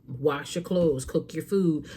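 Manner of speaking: ordinary talk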